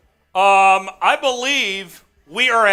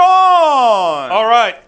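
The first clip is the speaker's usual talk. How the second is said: loud, drawn-out all right; loud cheer; 8 dB louder than usual. Real, not acted. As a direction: bellow it with joy. Reacting to donation.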